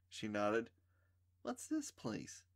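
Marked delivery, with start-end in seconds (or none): 1.4-2.4 put-on high, soft, slow dreamy girl. A narrator's reading. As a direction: ask it in a spacey little voice. Trisha dreamy and childlike.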